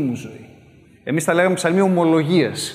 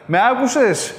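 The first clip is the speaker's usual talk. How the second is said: calling out; 7 semitones higher than usual. Retold or imitated. Calling someone in next room.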